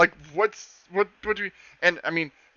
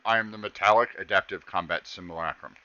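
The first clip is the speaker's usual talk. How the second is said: low, stiff robot announcement; 9 semitones lower, 3 dB quieter than usual. Acted. Max recites his full name in a low robot voice. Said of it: "I'm the".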